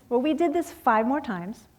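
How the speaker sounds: usual speaking voice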